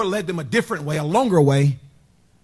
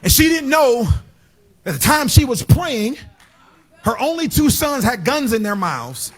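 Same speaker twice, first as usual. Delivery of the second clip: sermon peak, higher and higher; building preaching climax; 6 semitones higher than usual. Acted. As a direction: climb and call it out to the church. Preaching climax rising high.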